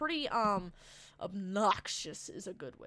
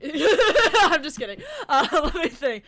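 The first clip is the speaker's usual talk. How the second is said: laughing; 7 semitones higher, 12 dB louder than usual. Real, not acted. Laughs at own joke.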